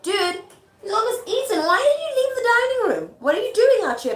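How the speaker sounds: high, incredulous mock telling-off; comic nagging wife, loud and high; exasperated scolding. Modern paraphrase of Lady Macbeth scolding.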